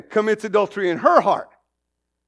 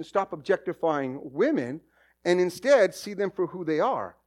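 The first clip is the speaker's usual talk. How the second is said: usual speaking voice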